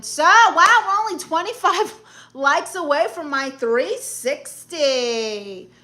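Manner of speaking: high, loud, drawn-out hype; excited announcement. Real, not acted. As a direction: hype up the crowd, laughing midway. Cheering on the like count.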